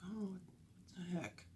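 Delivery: a hushed, breathy oh what the heck; whispered disbelief. Retold or imitated. Reacting to failed payment.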